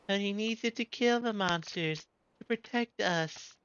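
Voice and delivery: put-on little girl, high and grateful; high child voice. Small girl thanking her rescuer, high child voice.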